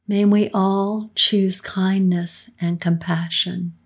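normal talking voice

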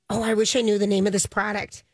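normal talking voice

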